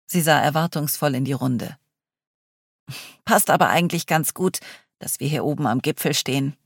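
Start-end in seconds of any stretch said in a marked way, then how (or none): none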